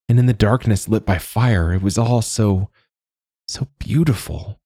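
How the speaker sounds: lost in fond memories; wistful young woman. Acted.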